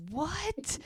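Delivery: high, incredulous what; mock disbelief at her joke; stunned what.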